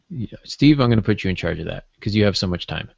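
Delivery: normal talking voice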